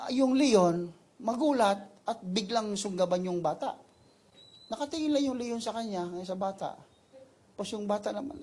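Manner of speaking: ordinary delivery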